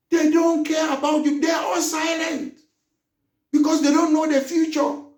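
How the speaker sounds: shouting in outrage at the idle parliament; angry shout. Real, not acted. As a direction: rail at the corrupt lawmakers. Angry tirade against parliamentarians.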